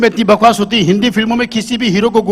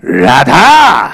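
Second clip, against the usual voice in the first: very slow, low, drawn-out call; Raaj Kumar calling Radha; 7 semitones lower, 5 dB louder, much slower than usual. Retold or imitated. Wounded Raaj Kumar calling his wife, slow deep drawn-out call. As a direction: stretch the name out in a deep dying-hero voice. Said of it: "अपनी बीवी को आवाज लगा रहा घायल राजकुमार".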